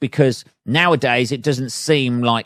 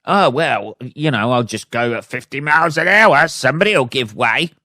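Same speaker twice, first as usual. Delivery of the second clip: mocking other drivers; 3 semitones higher, 4 dB louder than usual. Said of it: "They seem to just think".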